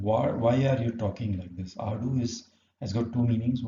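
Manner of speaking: normal talking voice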